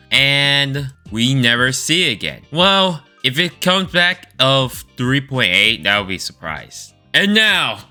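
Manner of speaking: normal talking voice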